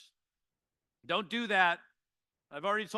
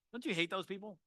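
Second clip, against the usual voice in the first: ordinary talk, nothing marked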